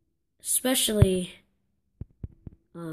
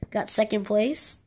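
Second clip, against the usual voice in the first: ordinary talk, nothing marked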